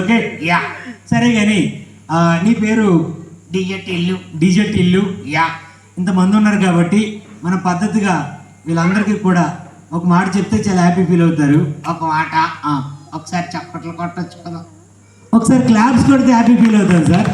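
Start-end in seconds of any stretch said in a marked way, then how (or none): 3.5-4.2 soft puppet introduction; puppet character. Acted. Puppet answering what its name is, soft puppet voice. 11.9-14.6 puppet character. Acted. Puppet asking the audience for applause, soft puppet voice.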